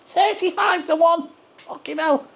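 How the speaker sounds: high-pitched, drawn-out disbelief; incredulous outburst. Acted. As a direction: exclaim it high, as if the odds are absurd. Reacts incredulously to betting odds.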